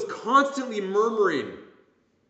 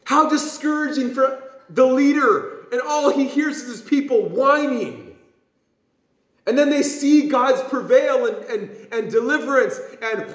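ordinary talk

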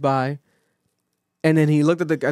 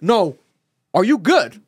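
insistent gangster-style re-ask, falling at the end; louder, punched "are you good"; 5 semitones higher, 4 dB louder, slower than usual. Retold or imitated. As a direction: press the question like a tough friend. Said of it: "[name] on some gangster shit".

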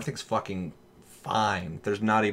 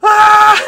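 very high, very loud fake scream; hammed-up PC scream; mock machine scream; 18 dB louder than usual. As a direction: scream like an overheating console. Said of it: "it starts going".

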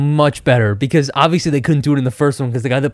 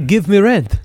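mock landlord voice; 5 semitones higher than usual. Mimics the landlord's line.

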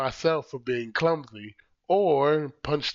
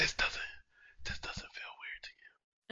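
whisper; 8 semitones higher, 13 dB quieter than usual. Shown as a demonstration. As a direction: whisper it to test the feeling. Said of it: "Why does that feel weird?".

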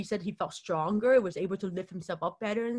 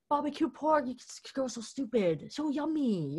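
high teasing mockery; a hint of mocking relatives laughing at a child; 6 semitones higher than usual. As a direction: taunt the crying girl. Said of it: "laughing at me".

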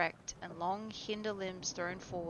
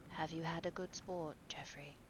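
hushed unvoiced question; whispered; 3 semitones lower, 12 dB quieter than usual. A narrator's reading. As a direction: breathe the question softly. Dorian speaks softly in shooting party.

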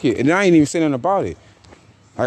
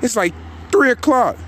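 disbelief; 8 semitones higher than usual. Real, not acted. Outraged at the late hour.

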